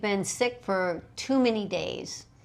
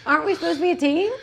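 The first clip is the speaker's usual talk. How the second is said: high, fast, laughing protest; the body asking the mind, high and laughing; laughing mock complaint; 9 semitones higher, 6 dB louder, much faster than usual.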